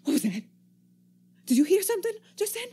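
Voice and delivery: fast, high, startled question; jumpy alarm. Acted. Stranger startled by a noise overhead, fast and high. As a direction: snap to alert at a sound.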